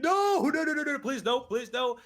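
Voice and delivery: high, loud, pleading no; begging the ball not to go in; anguished denial. Real, not acted. Caster dreads a goal.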